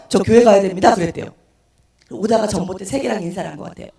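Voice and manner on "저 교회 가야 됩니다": loud, sudden announcement; retold loud declaration. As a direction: blurt it out at full volume. Drunk sudden declaration in retold story.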